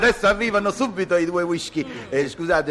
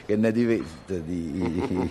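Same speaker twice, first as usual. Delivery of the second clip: slow teasing drawl; 8 semitones lower, 4 dB quieter, much slower than usual. Acted. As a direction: drag it out, teasing. Drawn-out suggestive pause before offer.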